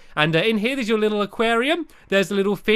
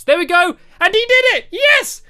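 excited cheer; 13 semitones higher, 7 dB louder than usual. Real, not acted. Cheering as Lee finishes jumps.

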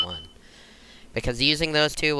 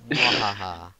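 mad scientist; 3 semitones lower than usual. Acted. Evil laugh during boss fight.

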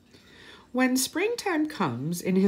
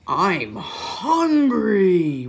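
slow, louder bear growl of hunger; mild roar; 6 dB louder, much slower than usual. Acted. Bear telling his friends, a slow mock roar. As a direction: drag out the hunger like a big bear. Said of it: "roars Bear".

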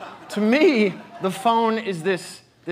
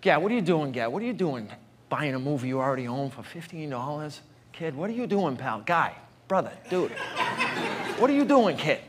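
put-on Boston accent; 3 semitones lower, 5 dB quieter than usual. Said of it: "so he has that accent".

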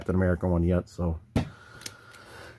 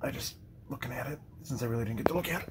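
whispering; 14 dB quieter, faster than usual. Real, not acted. Murmurs while examining figure.

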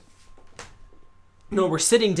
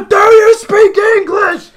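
mock shout; 13 semitones higher, 11 dB louder than usual. Shouting at the counter man who speaks no English, mock yell. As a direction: bellow it like a tourist who thinks volume helps. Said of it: "They raise their voice".

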